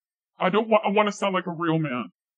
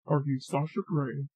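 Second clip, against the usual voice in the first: soft question; 2 semitones lower, 7 dB quieter than usual. Pretend. Testing a man's voice on someone, soft question. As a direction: ask it softly. First asking, then repeated after "What?.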